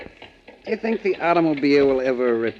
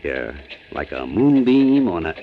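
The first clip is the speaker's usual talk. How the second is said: tender romantic; 8 semitones lower than usual. Acted. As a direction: croon sweet nothings to her. Hank romances Ann in carriage.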